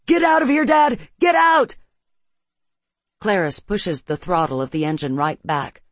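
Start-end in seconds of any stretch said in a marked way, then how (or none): 0.1-1.8 high, loud panicked yell; urgent shout. A narrator's reading. Son warns his father to flee the blast, urgent shout. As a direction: shout it to get him moving. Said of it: "His son shouts".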